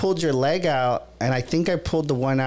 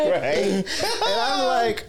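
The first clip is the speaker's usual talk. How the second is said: laughing; 7 semitones higher, much slower than usual. Real, not acted. Laughs retelling.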